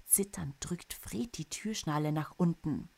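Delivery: usual speaking voice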